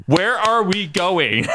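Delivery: high, drawn-out imitation of a fed-up friend; slow hammed-up complaint; mock exasperation. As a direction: drag out every word in mock frustration. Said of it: "Maddie being like".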